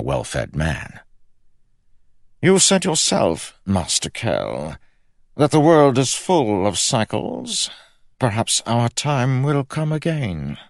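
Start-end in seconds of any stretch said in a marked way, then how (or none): 2.4-10.6 high, slow, drawn-out royal voice; scheming king. A narrator's reading. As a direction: stretch it out as a sly, high-voiced monarch.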